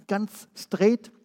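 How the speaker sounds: normal talking voice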